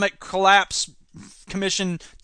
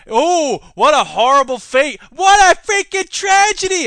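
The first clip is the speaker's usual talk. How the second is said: higher, louder mock dismay; sarcastic mock horror; 8 semitones higher, 8 dB louder than usual. Mocking Parliament's punishment, sarcastic horror. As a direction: overplay the tragedy sarcastically.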